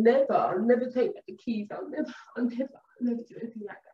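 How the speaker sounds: high, pleading sweet-talk; kindly luring. Acted. Woman phoning to lure her man back, put-on sweet pleading. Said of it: "lure you back in the kindest sort of way".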